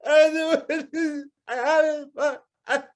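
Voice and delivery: can barely get the words out for laughing; helpless laughter. Real, not acted. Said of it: "I cannot stop".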